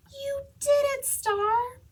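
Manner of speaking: high, loud, delighted cheer; proud girl cheering her dog; excited praise. Acted. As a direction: celebrate the dog's success out loud.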